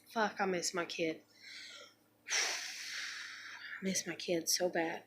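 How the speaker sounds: breathy, very soft longing, nearly whispered; heavy sighs. Real, not acted. Mother missing her absent child.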